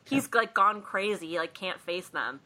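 ordinary delivery

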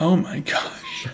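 slow, soft retold awe into a laugh; hushed mock wonder; mock amazement. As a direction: relive the revelation in a hushed, drawn-out way. Replays own amazement, laughing.

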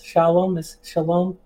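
"Shalom" said the American way; American pronunciation. Shown as a demonstration. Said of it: "that's how Americans pronounce".

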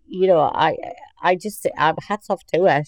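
normal talking voice

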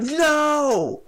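high, loud imitated yell. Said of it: "she's, like, yelling no".

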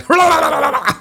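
high, loud tongue-flapping babble; imitated gibberish babble. Co-host imitates Bruce Almighty scene, high gibberish babble. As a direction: imitate garbled nonsense in a high voice. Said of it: "Bruce Almighty is like".

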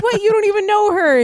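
angry ex. Retold or imitated. Retelling the ex snapping at a friend, outraged. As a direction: shoot it back in disbelief. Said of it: "And he was like".

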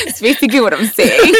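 laughing while talking; giggling through the words. Real, not acted. Laughing about not performing it.

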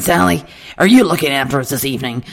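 put-on big Texan voice; Texan accent. Texan customer asking who serves his family, Texan accent. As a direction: imitate a huge Texan customer. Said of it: "He was a Texan".

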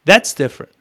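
normal talking voice

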